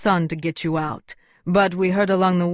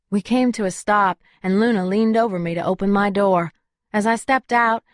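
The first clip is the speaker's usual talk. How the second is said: plain reading voice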